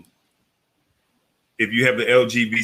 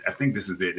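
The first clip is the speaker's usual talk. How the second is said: ordinary talk, nothing marked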